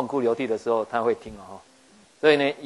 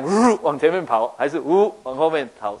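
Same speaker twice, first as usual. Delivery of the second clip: playful whoosh; 2 semitones higher, 4 dB louder than usual. Acted. Sun moving forward or back.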